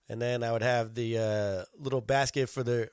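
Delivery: ordinary talk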